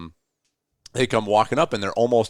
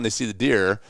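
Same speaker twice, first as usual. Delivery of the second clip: ordinary talk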